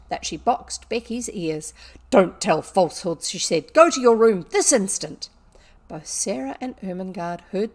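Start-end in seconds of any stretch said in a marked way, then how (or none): 2.1-3.3 loud, stern schoolmistress; acted angry order; angry reprimand. A narrator's reading. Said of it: "she was angry". 3.7-5.3 loud, furious schoolmistress command; acted outburst of anger; angry order. A narrator's reading. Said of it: "she was angry".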